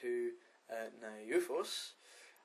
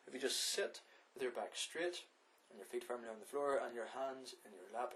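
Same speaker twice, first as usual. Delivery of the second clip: ordinary talk, nothing marked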